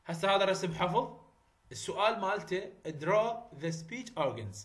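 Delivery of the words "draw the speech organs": very slow, each word spelled out for students; slow dictation. Shown as a demonstration. Teacher dictates exam question.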